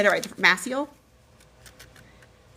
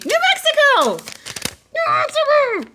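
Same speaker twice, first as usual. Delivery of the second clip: very high, loud repeated squeal; high-pitched celebratory yelling; excited shout; 6 dB louder than usual. Real, not acted. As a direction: holler it out at top pitch, thrilled.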